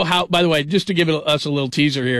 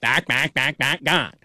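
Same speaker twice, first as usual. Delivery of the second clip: mock Berman duck; slower than usual. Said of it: "And he sounded like a duck".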